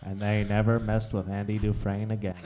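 Morgan Freeman impression. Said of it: "That sounded like Morgan Freeman".

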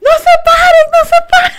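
high, loud laughing shout; panicked shouting. Retold or imitated. Coworker in the bathroom shouting not to stand, high loud laughing yell. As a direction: shout the warning, cracking up. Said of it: "y estaba".

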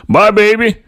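high, loud call across the house; calling out goodbye. A narrator's reading. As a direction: call out cheerfully on the way out. Father calls to wife leaving.

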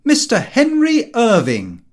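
slow, loud, high showman's announcement; grand announcement. Acted. Lupin presenting the actor, a slow loud announcement. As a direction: proclaim the name like a herald.

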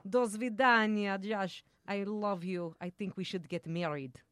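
put-on Russian accent. Actress mock-proposing to co-guest, comic Russian accent. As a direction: woo him in a playful Russian accent. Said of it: "a little Russian accent".

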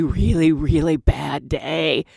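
slow, drawn-out weary complaint; mock world-weary; weary sigh. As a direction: drag out the bad day. Drinking senior girl quoted.

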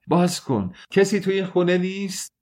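high-pitched cry for help; desperate calling out. A narrator's reading. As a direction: call to anyone who might hear outside. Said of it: "امیدوار بود که از خارج صدایش را بشنوند".

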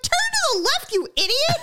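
very high, squeezed yell at a sibling; exasperated mock yell. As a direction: snap it out in hammed-up frustration. Sister snapping in imagined scene.

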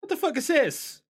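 high shocked squeal; Ursa startled. Acted. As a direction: shriek it out, caught off guard. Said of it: "and Ursa's like".

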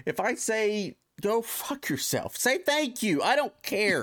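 fed-up rant about being offended; exasperated rant. Real, not acted. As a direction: build up to the punchline. Ranting about holiday greetings.

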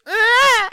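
put-on high wailing baby cry, laughing. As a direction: wail like a crying baby. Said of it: "my best attempt at Austin's baby cry".

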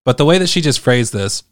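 ordinary talk

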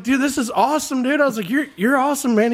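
high, starstruck praise; gushing fan. Retold or imitated. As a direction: gush like a fan meeting a star. Gushing at the player.

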